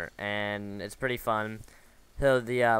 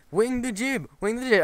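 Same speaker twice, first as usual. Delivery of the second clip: much higher put-on voice, insistent repeated order; mock the bossy crewmate barking the order; imitating a shipmate; 13 semitones higher, 4 dB louder than usual. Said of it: "the other guy on the boat's like".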